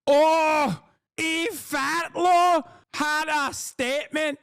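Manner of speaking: high, slow, shrill-free mocking woman's voice, exclamations of outrage; mocking a commentator's high outraged voice; mock impression of a woman. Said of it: "that's what she sounds like".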